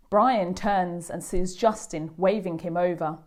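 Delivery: ordinary delivery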